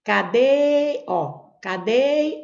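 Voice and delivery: "Cadê" with a long held final syllable; lengthened stressed syllable. Shown as a demonstration. Teacher showing how to find the tonic syllable, "Cadê" stretched at the end. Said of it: "aquela sílaba que eu demoro mais".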